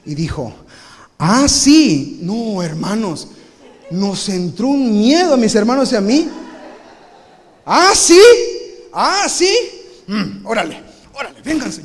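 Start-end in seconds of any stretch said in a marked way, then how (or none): 1.2-2.2 loud, menacing challenge through gritted teeth; a dad who's had enough; threatening dad. Retold or imitated. Dad accepting his kids' challenge, loud and menacing. 7.6-11.8 loud, high mock challenge; taunting dad. Dad taunting his kids before wrestling, loud and high.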